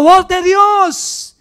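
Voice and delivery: the people hailing Herodes as a god, loud slow acclaim; crowd acclaiming. A narrator's reading. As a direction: cry it out like a crowd hailing a king. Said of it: "el pueblo aclamaba gritando".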